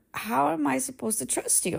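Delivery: high-pitched, exasperated challenge; accusing question. Retold or imitated. Parent retelling the confrontation with their child, exasperated. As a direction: demand an answer, pitch lifted.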